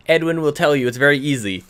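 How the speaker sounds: ordinary talk, nothing marked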